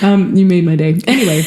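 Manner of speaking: ordinary delivery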